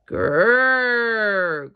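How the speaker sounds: loud toy bear growl; a playful long growl; teddy growl. Acted. The squeezed teddy growls at Little Bear. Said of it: "growled the teddy".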